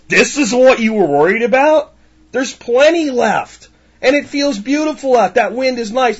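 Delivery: high breezy carefree grasshopper voice. Retold or imitated. Grasshopper shrugging off the ants' warning, breezy and high. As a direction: toss it off, careless and sunny.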